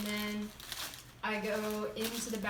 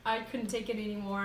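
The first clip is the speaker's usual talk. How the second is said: ordinary delivery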